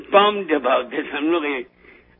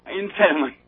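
ordinary talk, nothing marked

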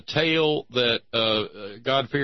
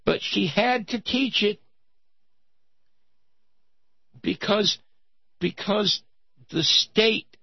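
high mock little-girl voice; crying little girl; 9 semitones higher than usual. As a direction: whine like a wronged child telling on teacher. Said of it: "And she said".

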